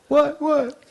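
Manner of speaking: put-on high squeaky kid; child voice. Child protesting.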